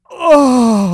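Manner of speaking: loud, high drawn-out mock moan; ecstatic moan. Imitating his weird reaction to being forgiven, mock ecstatic moan. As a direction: moan it out, hammed-up.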